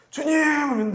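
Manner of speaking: high shout of Lord!; calling out loud. Pretend. Believer shouting to God, high loud call. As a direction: yell for the Lord as if he were far away. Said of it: "난 왜 이렇게 소리 지르니".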